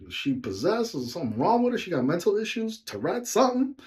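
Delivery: mock alarmed thoughts; alarmed inner thought. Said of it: "in my head, I was like".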